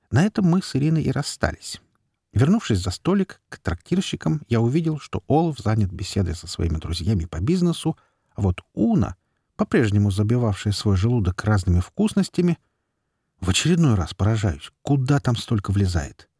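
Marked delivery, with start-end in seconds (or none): none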